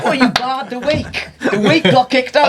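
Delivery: amused disbelief. Real, not acted. Listener incredulous at the story.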